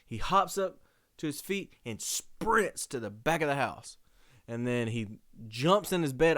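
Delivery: ordinary delivery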